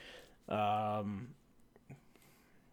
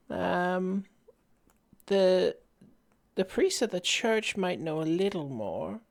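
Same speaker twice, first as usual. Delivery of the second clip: put-on frail old lady; 9 semitones higher, slower than usual. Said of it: "small, frail old lady".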